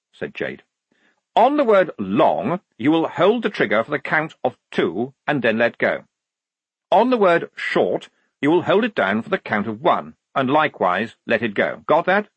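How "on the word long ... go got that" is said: precise, officious instructions; instructing lieutenant. Acted. Lieutenant teaching the private the signals, officious. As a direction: lay out each step, lifting the key words.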